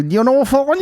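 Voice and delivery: high, mock West Cork accent; a light put-on Cork accent. The Cork fella chatting in the toilet, Cork accent. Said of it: "this Cork accent".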